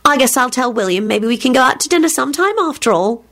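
put-on cheery, high girlish voice. A narrator's reading. Daughter planning dinner with William, cheery. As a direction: chirp it cheerily, pitched high. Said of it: "she responded cheerily".

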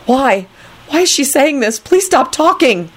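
high, exasperated begging; pained pleading. A narrator's reading. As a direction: beg silently for it to end. Pained inner pleading to stop.

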